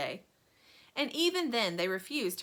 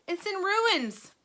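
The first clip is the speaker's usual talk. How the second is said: dismayed cry; 11 semitones higher, 4 dB louder, slower than usual. A narrator's reading. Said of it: "cried poor Mister Bucket".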